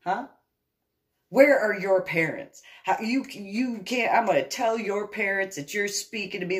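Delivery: high, loud mock indignation; imitated man with attitude. Intruder scolding a homeowner he takes for a child, indignant. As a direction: mimic a man demanding to see the parents. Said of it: "then he gets an attitude some more".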